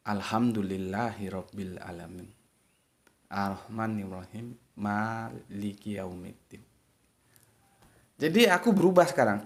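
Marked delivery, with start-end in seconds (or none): 0.1-6.7 soft, spoken recitation without melody, the way you would say it in conversation; just say the verse, no tune; plain dialog recitation. Shown as a demonstration. Teacher showing his new plain spoken reading, softly. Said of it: "Cukup begini".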